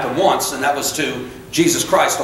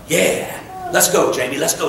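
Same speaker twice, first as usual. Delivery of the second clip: ordinary delivery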